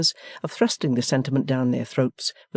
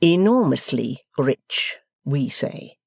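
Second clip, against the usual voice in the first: slow, pompous correction; host correcting the foreigner, slow and condescending; much slower than usual. Acted. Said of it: "in a condescending manner".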